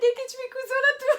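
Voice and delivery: high, loud, real laughing words; helpless laughing confession; laughing hard. Real, not acted. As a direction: squeal it out through laughter. Laughing confession about learned swear word.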